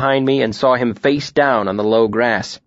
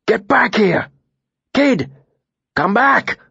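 high, urgent call back; 10 semitones higher than usual. A narrator's reading. Martin urgently calls the boy back.

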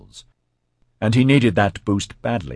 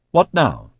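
mildly confused, raised-voice question; 8 semitones higher, 6 dB louder than usual. A narrator's reading. President asks her team after the failure, sounding confused. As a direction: ask it higher and louder, at a loss. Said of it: "She sounded confused".

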